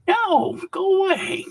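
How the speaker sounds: a high, whiny mock wail. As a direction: whimper it like a scared kid. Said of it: "And I was like".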